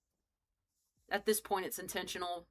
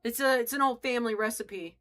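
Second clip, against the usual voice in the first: usual speaking voice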